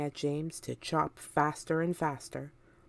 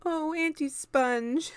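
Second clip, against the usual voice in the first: put-on higher, slow small boy's plea; a lonely child begging; James pleading; 11 semitones higher, slower than usual. A narrator's reading. James begging his aunts, high and pleading. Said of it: "James cried out".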